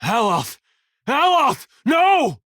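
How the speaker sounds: high, desperate shout; rising horror, yelled out; alarmed cry. Acted. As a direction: call out to a falling comrade. Companions falling in battle.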